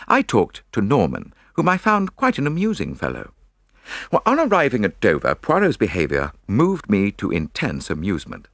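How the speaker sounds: usual speaking voice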